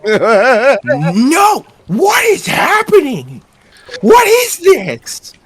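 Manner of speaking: high, drawn-out cry of disbelief; exasperated outburst. Acted. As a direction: wail it slowly, utterly bewildered. Acted panic at absurd interrogation.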